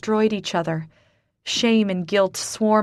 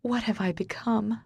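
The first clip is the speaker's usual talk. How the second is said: quiet despair; 3 semitones higher, 6 dB quieter than usual. Acted. Despairing inner question.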